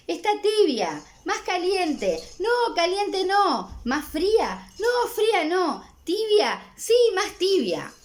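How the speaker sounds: teacher voicing mom and child testing the water, playful; mother and child bath banter. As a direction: play both sides of a fussy bath-temperature haggle.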